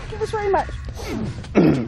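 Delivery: high, slow mock sob; crying tone. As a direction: whimper it like a sulking child. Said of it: "higher pitch, in a crying tone".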